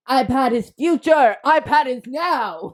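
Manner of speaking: loud, high, hammed-up announcement; mock proclamation. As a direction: proclaim it like a silly slogan. Comic proclamation on switching notes.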